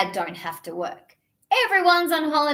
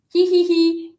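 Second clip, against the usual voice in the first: mock giggle; 5 semitones higher, 7 dB louder than usual. Laughing at dad joke.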